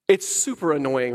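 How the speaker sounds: normal talking voice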